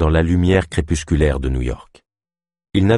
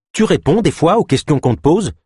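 exasperated question in a high pitch; impatient; 9 semitones higher than usual. A narrator's reading. Ava wants her questions answered, impatient. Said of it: "s'impatienta Ava".

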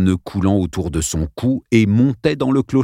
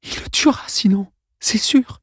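much higher, pleading; scared boy; 13 semitones higher than usual. Acted. Dale pleads with Arlène, high and scared. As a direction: voice climbs in panic.

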